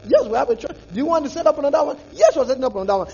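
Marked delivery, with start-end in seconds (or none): 0.9-2.0 quick, rushed nosy question; fast prying question. Pretend. Imagined questioner.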